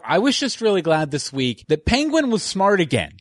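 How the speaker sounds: ordinary talk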